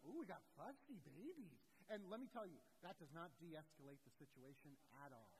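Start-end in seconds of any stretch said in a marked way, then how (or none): 0.1-1.6 swooping parental teasing; mock-cooing jibe at whiny children; mocking taunt. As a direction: tease the kids in exaggerated sing-song. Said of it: "If my children are, I'm like".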